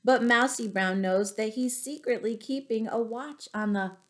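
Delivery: bouncy, sing-song read-aloud; storybook rhyme reading. A narrator's reading. Teacher reads the rhyming book to children, sing-song.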